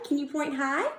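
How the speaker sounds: ordinary delivery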